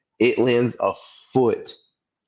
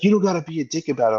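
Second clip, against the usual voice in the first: high, fast mock whine; annoyed dad; 9 semitones higher, much faster than usual. The dad snapping back at the narrator, high fast mock whine. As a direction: rattle it off as the irritated dad. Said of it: "he's like".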